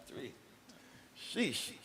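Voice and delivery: ordinary delivery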